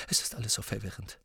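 hushed, hurried confusion; fast, quiet fluster; agitated. Acted. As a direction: say it quickly, under strain. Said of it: "sagte Professor McGonagall erregt".

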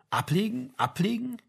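trying out the word. A narrator's reading. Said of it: "als würde er das Wort zum ersten Mal ausprobieren".

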